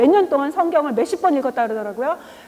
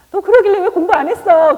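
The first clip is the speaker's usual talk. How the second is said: mock scolding; 5 semitones higher, 8 dB louder than usual. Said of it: "제가 어-, 어떻겠어요?".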